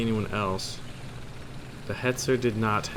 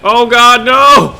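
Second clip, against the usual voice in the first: loud, high-pitched cry of dismay; drawn-out high holler; alarmed yell; 14 semitones higher, 17 dB louder, slower than usual. Real, not acted. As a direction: yell it out at the top of your voice. In-game mishap, cries out.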